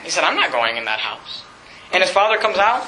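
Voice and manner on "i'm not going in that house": upset son. Retold or imitated. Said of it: "was upset".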